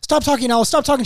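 mock snapping. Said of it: "they were just like".

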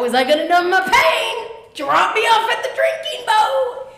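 climbing to a very high, loud holler; comic exclamation. Acted. Needing painkillers, calling for the drinking boat, comic high holler. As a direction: sing it out high like a gleeful punchline.

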